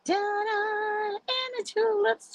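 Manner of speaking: high, bright showing-off call; sing-song ta-da reveal. Real, not acted. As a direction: present it like a magic trick. Showing off flowers on camera.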